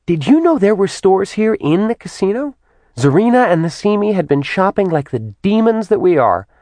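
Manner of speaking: put-on high-pitched, excited girlish demon; bubbly Simi demon. A narrator's reading. Simi gushing to Kat about shopping, high and chirpy.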